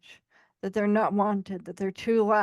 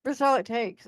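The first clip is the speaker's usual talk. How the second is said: usual speaking voice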